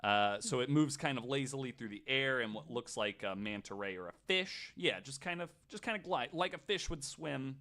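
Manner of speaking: ordinary talk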